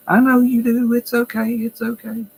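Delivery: high cooing mock reassurance; soothing nurse. Nurses comforting a crying resident, high mock-soothing voice. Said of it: "nurses, they're like".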